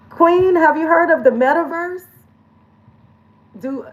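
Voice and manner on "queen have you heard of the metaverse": mock hype voice. Mock voice addressing herself.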